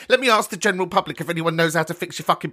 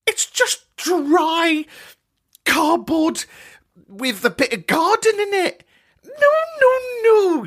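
high camp scorn for meatless stuffing; camp disgust; 11 semitones higher, slower than usual. Acted.

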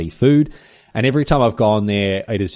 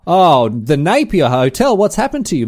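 dismayed lament; 8 semitones higher, 7 dB louder than usual. Real, not acted. Telling listeners the pub menu changed, loud dismay.

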